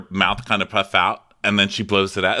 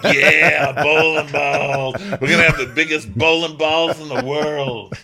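mock boastful American; 5 semitones higher, 5 dB louder than usual. Co-host mocking American bowling pride, big loud brag. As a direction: ham up a proud Texan bragging.

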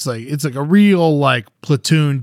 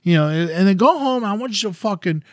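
higher-pitched, insistent elder's urging; urging pep talk; 8 semitones higher than usual. Retold or imitated. Village elder talks to a defeated man, urging. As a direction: push him to stand up, lightly acted.

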